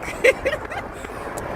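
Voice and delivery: airy squealing laugh; breathy high giggle. Real, not acted. Vlogger driving home, breathy excited giggle. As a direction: burst into a breathless, delighted giggle.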